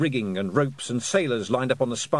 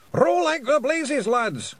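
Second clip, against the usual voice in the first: very high, drawn-out Scots yell; yelled order; 4 dB louder, slower than usual. A narrator's reading. Pictsie leader urging rowers away from whale, high yell. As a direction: holler it high to the crew. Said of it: "Rob Anybody yelled".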